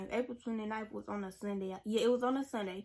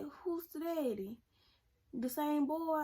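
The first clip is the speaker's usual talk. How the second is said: higher pitched, prying grandma questions; lightly voiced shocked grandma; 6 semitones higher than usual. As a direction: mimic a stunned grandmother demanding answers.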